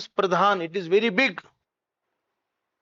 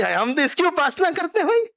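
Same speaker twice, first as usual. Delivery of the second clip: high, rising mock claim; acted boast; 9 semitones higher, much faster than usual. Teacher voices the man claiming his worship, high mock insistence. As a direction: rattle it off high, climbing to the end. Mimics the quoted man's claim.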